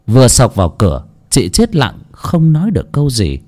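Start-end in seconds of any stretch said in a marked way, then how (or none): none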